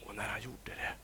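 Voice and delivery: whispered, holding breath; hushed whisper. A narrator's reading. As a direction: drop to a whisper. Moment of sudden calm.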